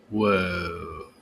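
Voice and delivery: ordinary talk, nothing marked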